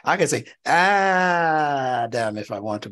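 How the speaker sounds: "Adam" with a long drawn first vowel, aaaa-dam; slow line with "Adam" pulled long at the start; stretched aleph. Shown as a demonstration. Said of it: "I can stretch that aleph out".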